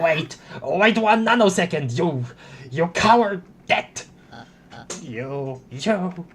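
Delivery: high-pitched theatrical protest; hammed-up indignation; indignant outburst. Acted outburst at a foe.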